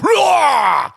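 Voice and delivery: sudden high, loud roar like a wild man; mock roar. Joking about the ice-bathing surfer, a high loud roar. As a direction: let out a big comic roar. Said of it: "Come and just be like".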